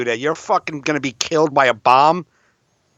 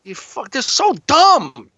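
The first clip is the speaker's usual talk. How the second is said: mocking voters; 9 semitones higher than usual. Comic mimicking border-watching voters, high mock voice. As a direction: ham up a paranoid voter.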